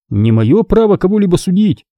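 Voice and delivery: tactful, guarded reply; cautious. A narrator's reading. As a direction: tread carefully, avoid accusing. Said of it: "осторожно сказал Оби-Ван".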